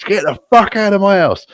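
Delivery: high-pitched mock outrage; angry eviction. Woman throws him out of her house, high mock fury. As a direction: act her furious order, pitched right up. Acted row: she orders him out.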